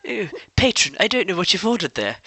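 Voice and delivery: a prissy posh English barman. Retold or imitated. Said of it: "posh English guy".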